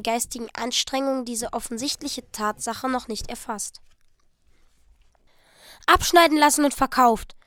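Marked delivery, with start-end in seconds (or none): 5.9-7.4 insistent. Acted. Della insists to Jim.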